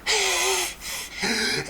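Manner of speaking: high wheezing laugh impression; imitated hard laugh. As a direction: mimic laughing too hard to make words. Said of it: "laughing so hard that it's just like a".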